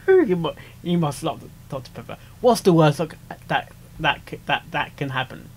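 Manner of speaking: ordinary delivery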